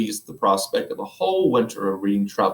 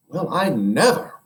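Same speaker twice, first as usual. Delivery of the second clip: impressed; 6 semitones higher than usual. Acted. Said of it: "very much impressed".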